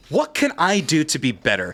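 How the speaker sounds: low, measured thinking-to-self voice; inner self-talk. Retold or imitated. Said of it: "in my head, I'm thinking to myself".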